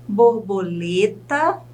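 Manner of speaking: slow "borboleta", LE held, rising end; stressed syllable exaggerated. Shown as a demonstration. Teacher has students find the stress, "borboleta" stretched on LE. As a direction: lean on LE in a drawn-out "borboleta". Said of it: "tente analisar qual dessas sílabas é a sílaba tônica".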